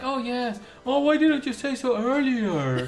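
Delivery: high, drawn-out corny enthusiasm; mock gushing delight. Client and therapist on matchmaking, mock gushing. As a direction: gush it like a sappy movie romance. Voices delighted reply in retold scene.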